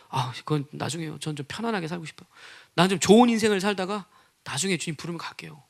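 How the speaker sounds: soft, low acted putting-off; evasive excuse. Believer putting off the cross, softly evasive. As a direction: make a meek excuse under your breath.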